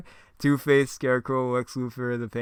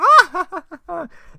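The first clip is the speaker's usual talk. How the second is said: high, loud villain cackle; evil cackle; 15 dB louder than usual. Acted. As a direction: cackle at your own joke. Joker laughs at own joke.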